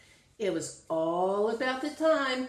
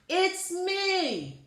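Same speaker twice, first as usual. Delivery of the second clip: shouted; 9 semitones higher than usual. A narrator's reading. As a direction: call out cheerfully to announce yourself. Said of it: "Rudy shouted".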